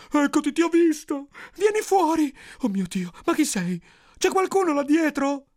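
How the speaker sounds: high, panicked calls into the dark; terrified. Acted. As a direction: cry out in terror, high-pitched. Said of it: "lo prese il terrore". (grida acute di terrore)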